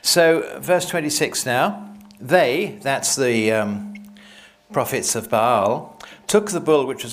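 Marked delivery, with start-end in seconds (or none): none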